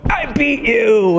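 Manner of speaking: slow, high triumphant crow; imitating son's high gloating yell; gloating kid. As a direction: crow it like a kid who won. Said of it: "jump at the top of the stairs and go".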